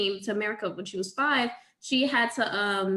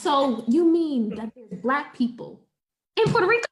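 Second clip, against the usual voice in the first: bewildered disbelief; 4 semitones higher, 3 dB louder than usual. Retold or imitated. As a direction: drag it out then shoot up, astonished. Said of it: "So I was like".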